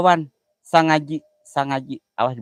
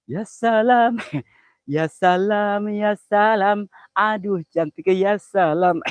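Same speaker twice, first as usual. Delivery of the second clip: higher, drawn-out ya salam; delighted ya salam; 8 semitones higher, 5 dB louder, much slower than usual. Acted. Streamer swooning over a pretty girl, drawn-out delight. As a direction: savour each ya salam with delight.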